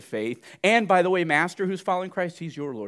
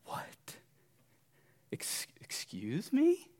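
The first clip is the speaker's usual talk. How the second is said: stunned whisper, slow and breathless; hushed incredulous excuse me; whispered disbelief; 7 semitones higher, 9 dB quieter, much slower than usual. Acted. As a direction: whisper it as if you can't believe your ears. Bondservant reacting in disbelief.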